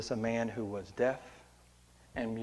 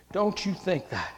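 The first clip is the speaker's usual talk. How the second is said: higher, slower retold scolding; insistent reproach; 6 semitones higher than usual. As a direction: press the warning home, drawing it out. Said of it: "She was mad at me".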